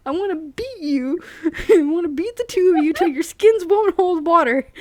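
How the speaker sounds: hammed-up angry threat from a grumpy character; snarled. A narrator's reading. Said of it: "he snarled".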